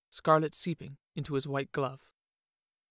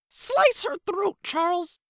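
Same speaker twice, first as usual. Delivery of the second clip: much higher, louder, slow shrieking princess; hammed-up shrill princess demanding blood; whimpering princess; 6 dB louder, slower than usual. A narrator's reading. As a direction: screech for her death like a spoiled brat. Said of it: "Abigail whimpered".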